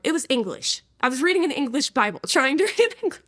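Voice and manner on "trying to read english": laughing. Real, not acted. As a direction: chuckle at the absurdity. Laughs at her own story.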